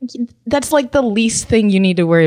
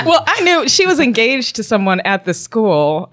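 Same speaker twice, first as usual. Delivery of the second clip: ordinary talk, nothing marked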